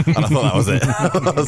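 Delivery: laughing. Real, not acted. Laughing at the reading.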